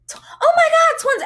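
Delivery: very high, loud gasping delight; imitated family excitement. In-laws hearing the twins reveal, imitated excitement. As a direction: squeal it like a thrilled relative. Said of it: "Everybody's excited".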